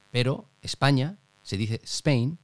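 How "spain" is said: Spain said slowly in English; English pronunciation. Shown as a demonstration. Host contrasting country names used in Japan, Spain in English. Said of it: "que es en inglés".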